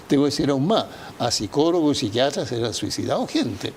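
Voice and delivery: ordinary delivery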